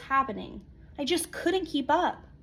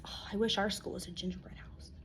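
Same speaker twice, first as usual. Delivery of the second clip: hushed aside; 4 semitones lower, 11 dB quieter, faster than usual. Acted. Wistful aside to the listener.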